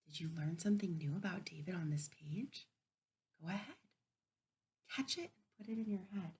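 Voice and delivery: soft, hushed question to the class; quiet teacher prompt. Real, not acted. Teacher softly prompting children during story reading.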